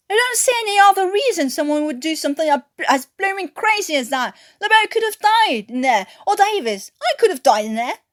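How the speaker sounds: loud and high, words tumbling fast; furious yelling. Acted. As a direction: tear into him nose to nose. Newkirk yells at Watkins.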